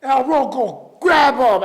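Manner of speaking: loud, high furious order; angry ejection. Retold or imitated. Host loudly ordering security to remove him. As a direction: throw him out of the studio.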